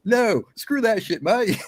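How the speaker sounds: high, drawn-out mock Australian outburst; worked up. Imitating friend who won't calm down, worked-up Australian accent. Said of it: "He would get so worked up".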